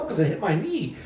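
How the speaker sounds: usual speaking voice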